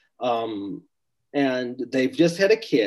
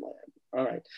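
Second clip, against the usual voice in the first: usual speaking voice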